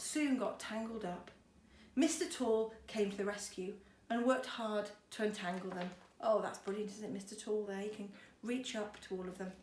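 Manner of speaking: usual speaking voice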